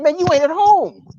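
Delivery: high-pitched retold scolding; mock exasperation. Comedian scolds half-naked roommate in retelling, exasperated.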